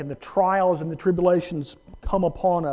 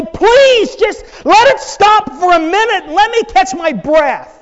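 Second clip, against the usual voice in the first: high, loud pleading; worn-out believer begging for a pause, high and loud; desperate plea; 10 semitones higher, 8 dB louder than usual. Retold or imitated. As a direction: plead for a moment to breathe. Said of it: "step out of time and say".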